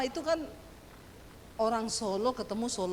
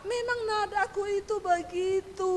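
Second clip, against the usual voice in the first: high, sustained protest; exasperated self-defence; 7 semitones higher than usual. Retold or imitated. Wife defending her natural tone to husband, high exasperated protest. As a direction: raise the pitch and hold out the ending.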